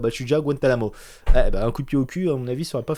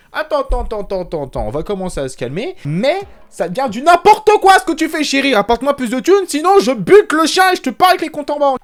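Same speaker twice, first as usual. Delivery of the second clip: put-on angry boyfriend; 12 semitones higher, 10 dB louder than usual. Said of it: "son mec, il s'est dit".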